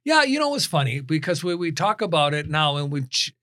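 normal talking voice

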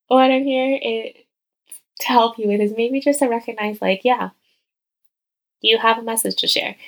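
ordinary talk

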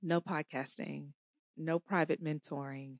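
a calm, hushed no; God's quiet firm word. Retold or imitated. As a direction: say it low and measured, with space between phrases. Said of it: "was like, "No".

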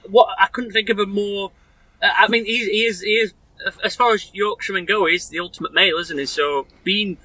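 ordinary delivery

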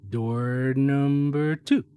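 stretched slow, rising on two; slow drawn-out announcement. Acted. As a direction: draw it out like a game show host. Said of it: "drawn-out door announcement".

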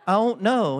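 "I don't know" slurred into one high, loud word; Oklahoma one-word slur. Shown as a demonstration. Boy replies to his dad, "I don't know" as one Oklahoma word. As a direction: run "I don't know" together with the d dropped. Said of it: "be in Oklahoma to really get that".

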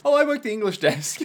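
lightly mocking clerk voice; mock Nicholas. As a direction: tease the character's self-importance. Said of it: "parodying the anime".

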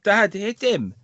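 high, lightly mocked child's shout; son shouting out. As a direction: imitate a boy calling to his dad. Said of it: "my son shouted out".

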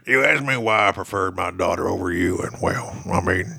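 lightly put-on low sling blade voice. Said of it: "I only save my sling blade voice".

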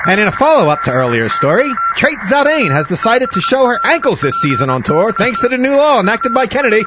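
put-on high, loud old-time newsreel announcer; grave 1940s war broadcast. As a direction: announce it like a wartime radio bulletin. Mock news bulletin again.

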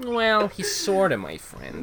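high, slow sly cartoon voice; grinning cartoon voice. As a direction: say it with a big sly grin. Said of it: "Doug says".

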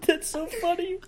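high, breathless laugh. Real, not acted. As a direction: still laughing as you say it.

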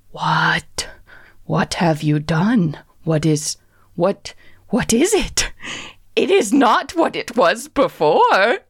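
disturbed little creature, questioning in disbelief. Acted. Gordon quivering at the changed witch, creature voice.

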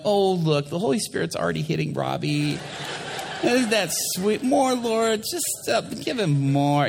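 high-pitched mock-adoring worshippers; gushing churchgoers. People in the back mistaking nerves for the Spirit, gushing. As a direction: gush piously, pitch well up. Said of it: "people in the back are going".

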